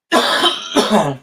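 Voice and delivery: a loud, harsh cough; coughing fit. Real, not acted. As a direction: cough hard mid-sentence. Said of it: "Sorry about the coughing".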